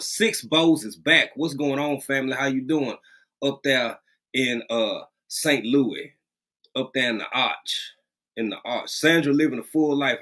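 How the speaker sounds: ordinary talk, nothing marked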